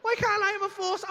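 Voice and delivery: high, affronted squeal; whine-shout it at the top of your range; indignant protest. Retold or imitated. Comedian argues with doubting mate, high indignant protest.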